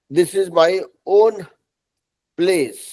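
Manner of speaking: ordinary talk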